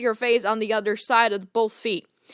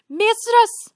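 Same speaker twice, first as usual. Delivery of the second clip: much higher excited cry; character cry; 10 semitones higher, 4 dB louder than usual. Acted. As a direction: cry out in rapture. Luna cries out.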